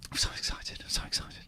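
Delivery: soft, voiceless whisper; excited whisper. Real, not acted. Host confides excitement after the song, whispering. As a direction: whisper it like a barely contained secret.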